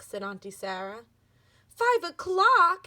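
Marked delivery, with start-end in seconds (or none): 1.7-2.9 high, loud, drawn-out shocked cry; startled exclaim. Acted. Annabelle realizing she is late, a high, loud, slow cry. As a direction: stretch it out in alarm. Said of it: "exclaimed Annabelle".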